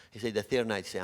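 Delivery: usual speaking voice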